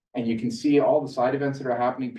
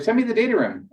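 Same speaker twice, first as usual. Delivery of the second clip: much higher and louder, a pushy investor asking; demanding investor; 10 semitones higher, 5 dB louder than usual. Retold or imitated. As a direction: play the investor pressing for the data room, high and loud. Investor demands the data room.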